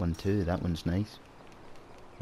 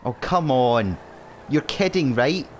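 loud, higher disbelief; exasperated; 5 semitones higher, 5 dB louder than usual. Real, not acted. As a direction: protest at the unfair game. Exasperated at game.

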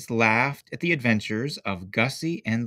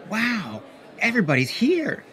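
excited; 11 semitones higher, 3 dB louder than usual. A narrator's reading. Excited kid at puppet show.

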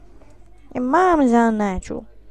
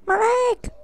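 hammed-up pain cry; 11 semitones higher than usual. Hurt character in game.

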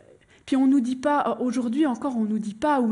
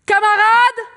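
loud, high orator's call to a crowd; rallying shout; 10 semitones higher, 10 dB louder than usual. Acted. Worker-priest hails the general assembly, rallying shout. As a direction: bellow it out to grab the whole assembly. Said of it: "il alpague toute l'Assemblée générale et il leur dit".

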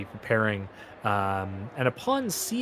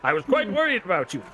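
fawning showman; 7 semitones higher, faster than usual. Acted. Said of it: "Malthus speaking".

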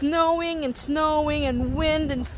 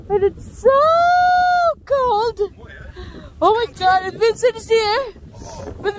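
shouting over wind; 6 semitones higher, 5 dB louder than usual. Real, not acted. Shouting in the snowstorm.

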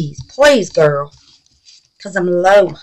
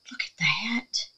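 hushed awe; 12 dB quieter than usual. Real, not acted. Admiring craft piece, hushed awe.

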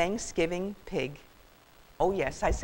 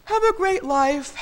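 slow, high, loud yawned farewell; drawn-out sleepy dismissal; yawning; 13 semitones higher, 7 dB louder than usual. A narrator's reading. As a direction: say goodbye through a huge yawn. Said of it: "Sam yawned again".

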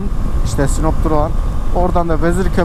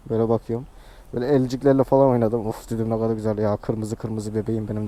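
tender; 8 semitones lower, 8 dB quieter than usual. Retold or imitated. Tender talk to his motorbike.

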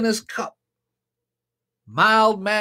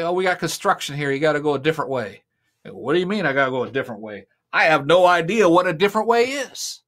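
lines rushed out fast, half-acted; rapid acted exchange; 4 semitones lower, much faster than usual. Preacher acting out a street exchange, rushed and fast. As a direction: rattle off both voices quickly. Bystanders redirect blind Bartimaeus.

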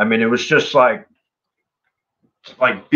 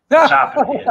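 bewildered high cry; 13 semitones higher, 4 dB louder than usual. Retold or imitated. Retelling confusion at the wild gig.

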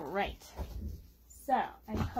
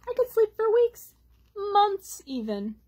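lightly voiced tired bear; 10 semitones higher, 6 dB louder than usual.

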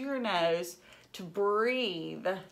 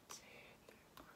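soft whispered count; 29 dB quieter, much faster than usual. Acted. Teacher counting tails in a whisper. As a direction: count under your breath.

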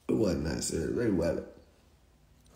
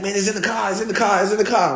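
a hint of a flustered Rambo, higher and rising louder; imitating Rambo's excuse; 7 semitones higher, 5 dB louder than usual. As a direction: repeat the excuse, getting louder each time. Rambo's panicked excuse quoted.

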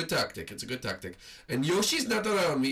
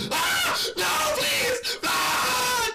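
high shouted plea, hammed up; mock terrified scream; 4 dB louder, much slower than usual. Gamer pleading with the killer who found him, hammed-up scream.

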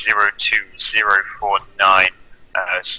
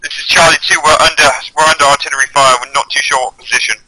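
loud, urgent, higher radio report; urgent shout under fire; 3 semitones higher, 6 dB louder than usual. Acted. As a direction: yell it over incoming shells.

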